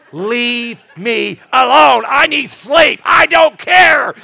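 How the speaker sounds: loud, slow, drawn-out yell; exhausted angry shout. Retold or imitated. Sleepless pastor yelling at the crying girl, loud and slow. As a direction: snap at her from sheer exhaustion.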